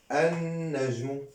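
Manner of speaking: "النجم" slowly as an-najmu with a held n; pronunciation demo. Shown as a demonstration. Teacher models the spoken form, slow held n. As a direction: linger on the double n of "النجم". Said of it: "Prononce النجم".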